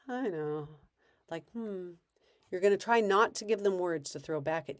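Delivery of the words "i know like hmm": brain dead reply. Shown as a demonstration. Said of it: "brain dead".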